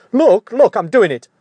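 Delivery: frantic eager-to-please cry; desperate. Acted. Giant frantically obeys.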